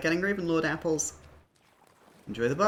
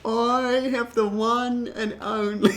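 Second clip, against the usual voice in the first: higher, slow put-on accent, fighting laughter; Pyro; 7 semitones higher, slower than usual. Player attempting Pyro's written-out voice, struggling accent. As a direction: labour through the phonetic accent while trying not to laugh. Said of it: "can't do this fucking voice that you've written out the phonetics for".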